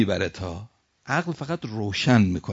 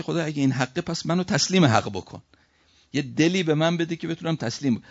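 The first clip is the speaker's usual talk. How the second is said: higher-pitched, emphatic imagined plea; pleading prayer; 7 semitones higher, 4 dB louder than usual. Pretend. Preacher voicing the prayer the man should say, pleading. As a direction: press the request earnestly upward. Models the expected prayer of submission.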